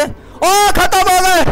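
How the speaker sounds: drawn-out, high whoop of relief; excited cry. Real, not acted. Gamer celebrating that the children are finished, excited cry. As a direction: let it ring out high and slow.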